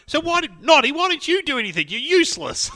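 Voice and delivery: high, loud mock-angry mum; mum scolding. Mum telling off the useless brother, high and loud. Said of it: "I still remember Mum going".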